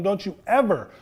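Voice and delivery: acted stern scolding, hard on EVER; scolding grandma. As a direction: lay down the law to a child, slowing at the end. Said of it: "spanked his butt and told him".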